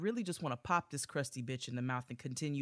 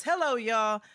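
reading letter voice; 5 semitones higher, 7 dB louder than usual. Retold or imitated. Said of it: "She says".

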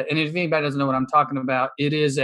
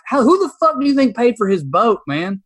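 higher, louder indignant outburst; heated outburst; 8 semitones higher, 5 dB louder than usual. Real, not acted. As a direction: let the anger burst out on the question. Heated rant about Southern elites.

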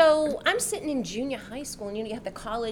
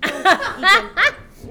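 laughing; 9 semitones higher, 12 dB louder, slower than usual. Real, not acted. Laughing at the Botox joke.

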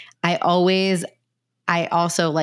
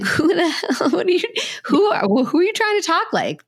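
much higher, mock-incredulous kid reaction; acted disbelief, pitched way up; 9 semitones higher than usual. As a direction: call out the phony talk in a high, laughing disbelief. Imagined kids reacting to parent's fake talk.

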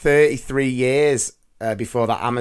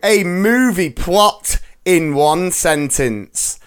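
announcer voice; 6 semitones higher, 4 dB louder, slower than usual. Acted. Segment intro over music.

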